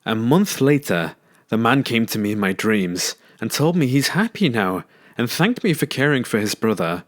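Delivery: usual speaking voice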